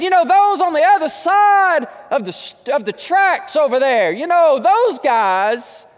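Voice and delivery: high, loud pulpit shout; loud preaching taunt. Acted. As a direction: call it out with pointed emphasis. Preacher acting out scornful naming of Samaritans.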